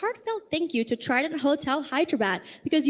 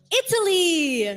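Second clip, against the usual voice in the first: announcer reveal; 6 semitones higher, 4 dB louder, slower than usual. Real, not acted. Host announces top five contestant.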